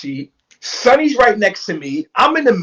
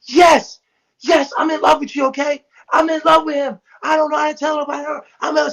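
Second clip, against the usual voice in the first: snapping confession; 6 semitones higher than usual. Retold or imitated. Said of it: "She starts snapping".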